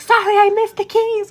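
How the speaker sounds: high, loud sarcastic apology; hammed-up mock sorry, fired back; retold bickering. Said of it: "we were going back and forth".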